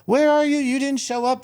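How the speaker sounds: high, loud mock scream; retold screaming. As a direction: shriek at him like an angry agent. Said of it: "called me up screaming".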